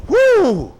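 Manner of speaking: loud high church whoop. Retold or imitated. Preacher imitating churchgoers shouting in praise, loud whoop. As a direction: let out a joyful whoop.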